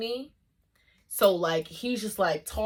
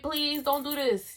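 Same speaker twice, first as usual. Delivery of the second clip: pleading; 8 semitones higher than usual. Retold or imitated. Class imagined begging him to stop.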